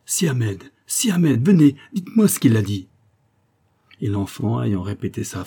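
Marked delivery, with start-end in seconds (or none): 0.9-2.9 higher, louder pleading call; urgent raised plea; urgent cry for help. A narrator's reading. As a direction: call him as if for rescue. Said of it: "comme si elle eût appelé au secours".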